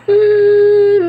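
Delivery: loud, high burst of laughter. Real, not acted. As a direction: laugh out in giddy delight. Hysterical joy.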